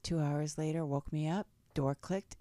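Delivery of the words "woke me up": usual speaking voice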